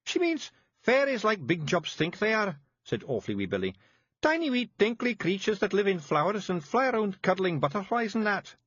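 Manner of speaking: high, thick Scots pixie voice; small tough Scots fairy breaking bad news; Scottish Feegle voice. A narrator's reading. Feegle Billy.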